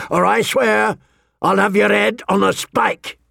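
rage, barely getting words out; choking rage. Acted. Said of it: "choking on his rage".

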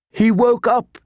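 high, tearful voice; through tears. A narrator's reading. Jon reads news of his brother, tearful. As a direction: choke it out high, overcome with relief. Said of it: "through the tears".

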